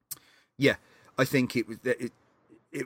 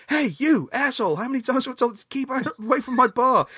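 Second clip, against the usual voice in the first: mock yell; 11 semitones higher, 6 dB louder, faster than usual. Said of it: "bartender just comes and goes".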